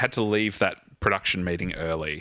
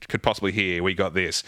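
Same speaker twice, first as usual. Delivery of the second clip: ordinary talk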